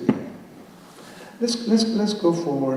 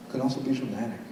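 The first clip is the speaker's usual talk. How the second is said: much softer, dramatic; softer, hushed and dramatic; 3 semitones lower, 8 dB quieter, faster than usual. Shown as a demonstration. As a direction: drop to a theatrical near-whisper. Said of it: "And we can also be dramatic".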